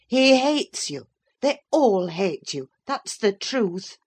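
slow, high, pointed scorn; bitter accusation. A narrator's reading. Nurse telling the father his family hates him, bitterly. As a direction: spit each word at him slowly. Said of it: "I continued".

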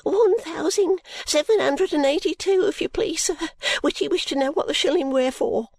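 put-on high, rushed servant-girl voice; high, breathless maid voice. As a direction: blurt it out short of breath.

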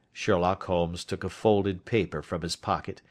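ordinary delivery

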